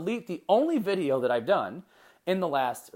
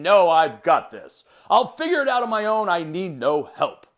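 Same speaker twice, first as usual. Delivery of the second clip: mock stubborn boast; 2 semitones higher, 9 dB louder than usual. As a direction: ham up a proud loner who says he needs nobody. Mocking stubborn self-reliant attitude.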